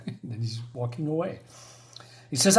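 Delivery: normal talking voice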